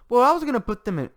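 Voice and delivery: high, loud, broken off after "in"; cut off mid-sentence. Retold or imitated. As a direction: imitate someone stopping short on "in". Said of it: "It stops mid-sentence".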